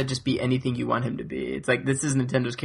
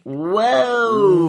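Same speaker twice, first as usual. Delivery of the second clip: shocked whoa; 9 semitones higher, 7 dB louder than usual. Real, not acted. Reacting to loving the prequels.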